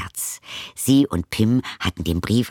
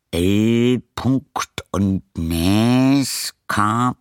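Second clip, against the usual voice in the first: murmuring; 4 dB louder, much slower than usual. Acted. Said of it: "murmelte er".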